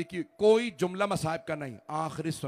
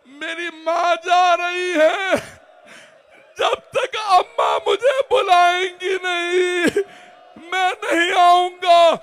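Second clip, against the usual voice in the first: slow, much higher and louder, sobbing plea; weeping son's cry; 15 dB louder, slower than usual. Acted. Son weeping at the door to his father, high wailing plea. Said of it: "रोने लगे".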